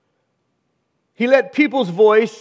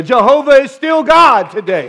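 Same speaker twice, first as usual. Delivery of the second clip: higher, louder triumphant declaration; bold proclamation; 7 semitones higher, 5 dB louder than usual. Real, not acted. Preacher proclaiming to the church, louder triumphant declaration. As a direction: proclaim it with full conviction.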